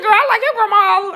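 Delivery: high, loud, rushed exclamation; re-enacted gleeful shout; excited outburst. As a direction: blurt it out at full volume. Blurts out delight at the story.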